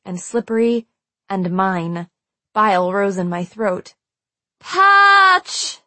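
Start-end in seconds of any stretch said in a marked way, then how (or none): 4.6-5.8 long, high, loud scream of a name; desperate cry into the night; terrified scream. A narrator's reading. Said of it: "I screamed into the night with nothing short of terror and absolute desperation".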